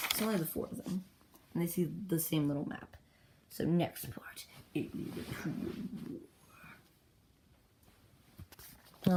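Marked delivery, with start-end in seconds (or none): 4.7-9.1 hushed, slow title reveal; drawn-out title announcement. Acted. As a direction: draw the title out softly and slowly. Announces the next part's title.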